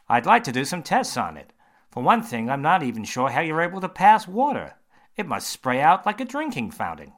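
a confident doctor holding forth; surgeon voice. Acted. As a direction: surgeon quizzing James, bright and loud.

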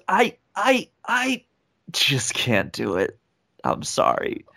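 high stammered start, then slow, sinking apology; agonised refusal. Retold or imitated. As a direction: imitate a torn judge giving up. Acts out judge's agonised refusal.

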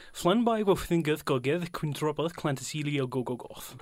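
rapid attempt at the long Welsh station name; "Flynn by Wolfingith Gogeth Quintropoth Clantasilio Gogogoth" rattled off as one Welsh word; Welsh pronunciation. Shown as a demonstration. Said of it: "do that longest station in Wales".

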